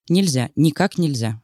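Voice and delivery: usual speaking voice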